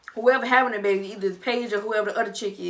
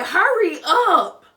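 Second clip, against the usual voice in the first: loud, high, drawn-out demand; impatient yell; 7 semitones higher, 7 dB louder, much slower than usual. Acted. Long-married spouse to partner undressing, impatient yell. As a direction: holler it slowly at a dawdling spouse.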